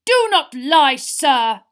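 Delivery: loud, measured bellow of accusation; angry shout. A narrator's reading. Crouch accusing the boy, furious shout. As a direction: thunder it at the culprit. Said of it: "shouted Mister Crouch".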